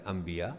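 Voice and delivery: انبیاء said as light "ambiya", nothing doubled; without shad. Shown as a demonstration. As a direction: say انبیاء softly as "am-bi-ya", no pressed consonant. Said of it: "بغیر شد کے جو لفظ ہے".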